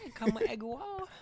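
high mock broken-English accent; mock foreign accent. Friends trading a meme line, mock foreign accent.

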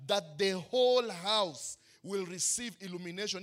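ordinary talk, nothing marked

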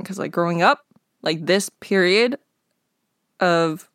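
normal talking voice

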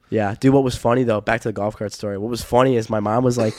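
ordinary delivery

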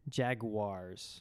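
Jaguars said slowly as jag-you-ahrz; overenunciated Jaguars; spelled-out Jaguars. Shown as a demonstration. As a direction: read Jaguars letter by letter. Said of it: "that's how it's spelled".

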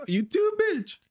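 high squeaky mock wife; mock old wife. The old man's wife insulting him back, high mock voice. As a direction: squeal it like a cranky old lady.

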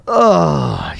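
usual speaking voice

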